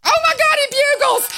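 high excited squeal; thrilled. Retold or imitated. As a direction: squeal with joy at the dog. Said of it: "I was like".

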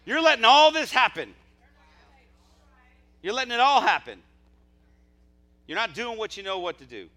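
high, loud exasperated outburst; acting out fed-up, yelled rebuke; frustrated shout. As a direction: shout it in disbelief at letting them down. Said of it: "I think he's frustrated".

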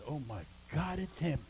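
very soft and low, retold shock; hushed disbelief. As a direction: drop almost to nothing in awe. Stunned at finding old friend.

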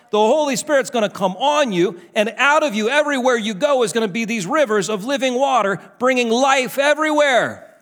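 high, driving preacher delivery; full-force preaching. Real, not acted.

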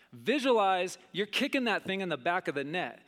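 ordinary delivery

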